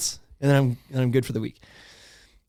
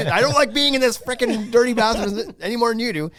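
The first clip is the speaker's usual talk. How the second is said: high-pitched exasperated rant; frustrated parent; 10 semitones higher, 4 dB louder than usual. Retold or imitated. Parent to child stuck in dirty bathroom, mock frustrated rant. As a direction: plead and scold through a laugh. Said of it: "getting so frustrated that we're like".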